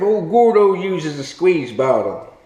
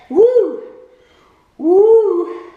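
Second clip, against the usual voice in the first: high, loud whoop after a burning sip; whiskey whoop; 9 semitones higher, 4 dB louder than usual. Real, not acted. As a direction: whoop as the liquor hits. After sipping strong whiskey.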